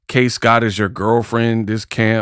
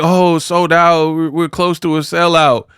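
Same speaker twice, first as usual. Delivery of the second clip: mocking imitation; 6 semitones higher than usual. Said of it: "and you're like".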